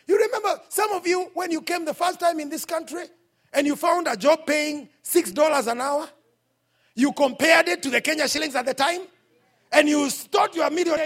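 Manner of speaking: loud, high preaching climax; preacher raising his voice over the crowd; fiery preaching. Real, not acted. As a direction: drive it home to the congregation. Preaching at full force.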